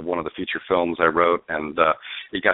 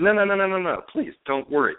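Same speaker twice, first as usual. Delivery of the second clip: retold eager no-no-no, pitched much higher; eager reassurance; 12 semitones higher than usual. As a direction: mimic her rushing to reassure, a bit hammed-up. Producer eagerly brushing aside hesitation.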